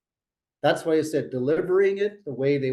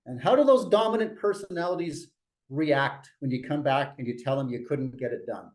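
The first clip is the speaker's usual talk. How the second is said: normal talking voice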